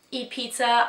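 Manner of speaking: ordinary talk, nothing marked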